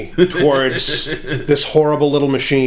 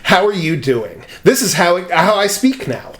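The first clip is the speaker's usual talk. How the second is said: high, bright assistant voice easing down by the end; smart speaker impression; 4 semitones higher than usual. Host imitates the device's new voice, bright and condescending. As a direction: put on a chirpy, projected voice-assistant voice. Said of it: "do a bit of an impression here".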